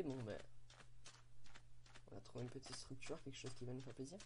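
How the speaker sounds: ordinary talk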